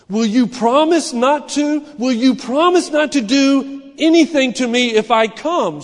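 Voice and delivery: high, loud, anxious begging; scared pleading girl. Acted. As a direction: plead nervously with a lion. Frightened girl pleading with lion.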